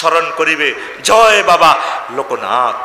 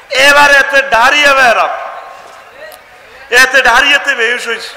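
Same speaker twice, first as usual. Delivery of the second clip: excited telling; 8 semitones higher, 5 dB louder than usual. Real, not acted. Excited retelling, big lift.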